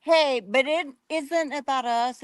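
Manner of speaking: ordinary delivery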